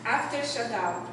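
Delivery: ordinary talk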